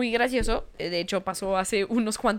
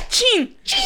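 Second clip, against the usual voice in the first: imitating dad's shout; 11 semitones higher, 4 dB louder than usual. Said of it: "Y lo".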